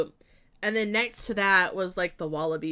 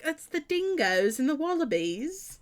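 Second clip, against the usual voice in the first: higher, amateur Aussie accent; Australian accent; 7 semitones higher than usual. Shown as a demonstration. Showing chat her zoo joke in a playful Australian accent. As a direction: have a go at an Australian accent, pitched up. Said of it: "That's as good as it gets".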